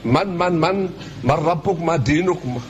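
ordinary talk

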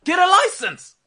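loud high shout. Real, not acted. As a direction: yell it out in disbelief. Calling out to the footballer.

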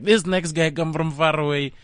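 Finnish MC introduction. Retold or imitated. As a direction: announce him as a Finnish host, building to a big finish. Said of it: "Then he goes on stage".

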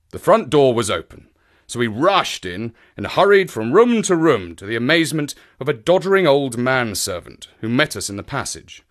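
ordinary delivery, nothing marked

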